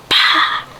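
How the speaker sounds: a quick, unvoiced "pa" for the punch; punch sound. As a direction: spit out the sound of a fist landing.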